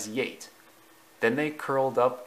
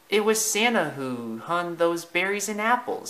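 higher-pitched animal character; Thumper's character voice; 6 semitones higher than usual. Acted. Thumper tells Bambi who decorated the tree, high character voice. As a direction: voice it high, as a storybook figure.

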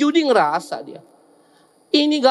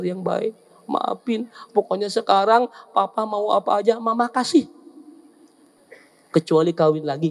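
sweet pleading wife; 6 semitones lower, 3 dB quieter than usual. Acted. Wife apologizes sweetly to husband.

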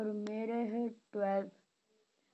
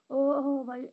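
alarmed shout; 3 semitones higher, 8 dB louder, slower than usual. Real, not acted. Sudden alarm in fight.

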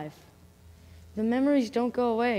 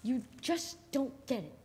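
normal talking voice

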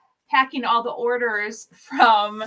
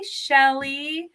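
ordinary talk, nothing marked

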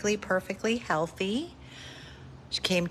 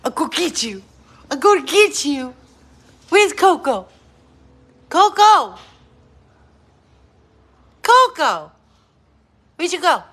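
much higher and louder, excited calling; playful chase calls; 8 semitones higher, 10 dB louder than usual. Real, not acted. Owner chasing her zooming dog, shouting excitedly. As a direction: call out gleefully like a chase game.

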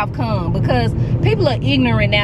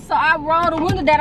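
ordinary talk, nothing marked

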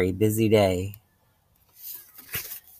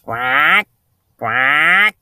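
loud quacks sliding upward; storybook duck call, rising; quacking duck; 6 dB louder than usual. Acted. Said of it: "called the duck".

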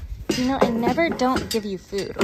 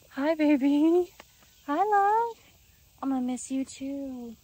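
cooing; 5 semitones higher than usual. Real, not acted. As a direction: coo a fond hello to a pet. Greeting horse lovingly.

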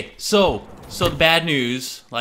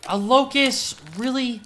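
incredulous surprise; 8 semitones higher than usual. Real, not acted. Disappointed by weapon drop.

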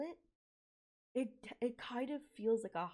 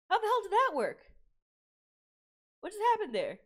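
loud, high-pitched astonished questions; shocked disbelief; 7 semitones higher, 5 dB louder than usual. Real, not acted. Viewer baffled by Titan powers, loud high disbelief. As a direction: blurt it out in stunned amazement. Shocked reaction to a plot twist.

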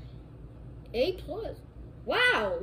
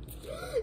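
sharp high gasp of delight; excited gasp; 5 dB quieter than usual. Acted. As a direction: gasp like the best gift ever. Kid hears he gets a Switch.

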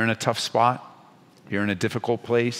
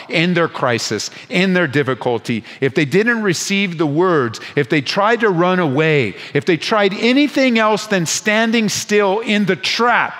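climbing higher and louder, slowing on each if; rising preaching shout; 7 semitones higher, 4 dB louder than usual. Real, not acted. Preacher warning the congregation, rising to a loud climax. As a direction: build the warning to a near shout.

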